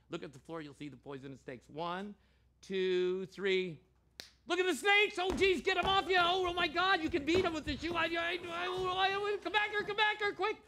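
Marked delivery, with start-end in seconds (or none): 4.5-10.6 high, loud, frantic yelling; mock panic. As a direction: play up the alarm for laughs. Mock panic over snakes.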